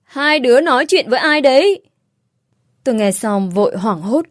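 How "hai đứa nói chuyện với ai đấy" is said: higher, raised questioning call; calling out questioningly. A narrator's reading. Woman hears children talking to someone, raised questioning call.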